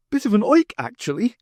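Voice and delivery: put-on high, loud, snooty young toff; snobbish Jeremy. Jeremy dismissing the stranger, high snooty toff voice. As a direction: sniff it out like a snob.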